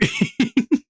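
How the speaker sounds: very high giggling fit; real laugh. Real, not acted. Laughing at a funny mishap.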